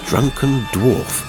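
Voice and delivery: usual speaking voice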